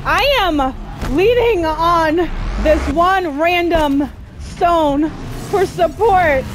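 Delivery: slow, drawn-out words; slow hammed-up weary narration; mock dramatic. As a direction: savour it like a weary hero.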